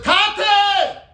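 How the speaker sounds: high desperate shout of Tate; screaming for father. Acted. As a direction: cry out Tate at the top of the voice. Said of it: "And he started screaming".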